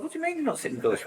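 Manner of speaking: high, fast, disbelieving protest; shocked kid. Retold or imitated. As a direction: squeal it out like an outraged kid. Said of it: "We'd be like".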